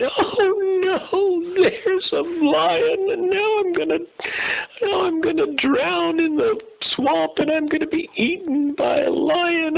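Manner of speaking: high, slow, whiny sobbing zebra voice; overly dramatic zebra. Acted. Zebra stuck in the mud wailing to himself, hammed-up sobbing. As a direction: wail like a hammed-up crybaby zebra. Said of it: "very, uh, emotional about the whole thing".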